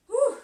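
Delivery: sharp high exhale, winded; loud winded whew. Real, not acted. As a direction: blow out a big breath. Exhales loudly between exercises.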